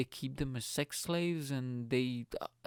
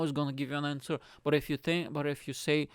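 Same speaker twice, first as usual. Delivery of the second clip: normal talking voice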